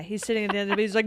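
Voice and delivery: usual speaking voice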